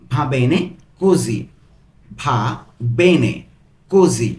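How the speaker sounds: "va ... bene ... così" with separating pauses; broken into pieces. Shown as a demonstration. Teacher giving the Italian phrase to learners, word by word. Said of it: "ভেঙে ভেঙে পড়ছি".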